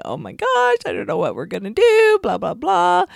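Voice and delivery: high, fast, mock-frantic wail; mock panic. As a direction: ham up a flustered, panicky outburst. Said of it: "instantly be crying and going".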